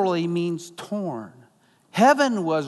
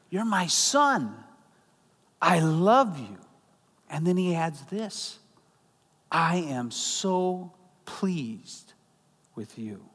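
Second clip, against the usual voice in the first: slow, softer, tender father's words, fading away; tender blessing; 3 dB quieter, slower than usual. Acted. Pastor voicing the Father blessing Jesus, slow and soft. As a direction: let each phrase land gently, slowly.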